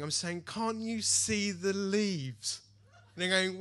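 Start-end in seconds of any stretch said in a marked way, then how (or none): 0.4-2.6 slow, drawn-out emotional wonder; hammed-up amazement, very slow; mock awestruck. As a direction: stretch it out like an overwhelmed new believer. Acting himself weeping in wonder.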